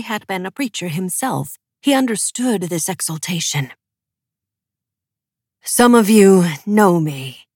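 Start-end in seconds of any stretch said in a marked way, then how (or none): 5.6-7.5 carrying voice. A narrator's reading. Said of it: "his voice carrying over the crowd".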